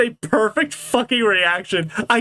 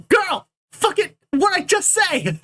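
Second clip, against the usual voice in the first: high, slow, exasperated outburst; exasperated scolding; 10 semitones higher than usual. Acted. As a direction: scold a troublemaker in a raised pitch. Acted scolding of Spring Bonnie.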